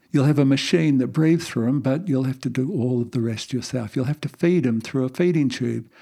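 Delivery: ordinary talk, nothing marked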